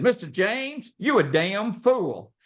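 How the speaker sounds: high, drawn-out imitation of a salesman; exasperated salesman. Salesman scolding dad for refusing the car, exasperated. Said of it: "He said".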